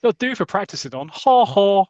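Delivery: put-on posh boys. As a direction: gloat in a mock posh voice. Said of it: "that's my impression of a posh boys".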